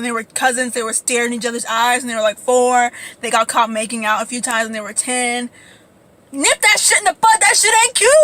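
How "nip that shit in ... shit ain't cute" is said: high-pitched indignant telling-off; scolding. Acted. Speaker tells off cousins who got caught making out, scolding. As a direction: scold them in a raised, fed-up pitch.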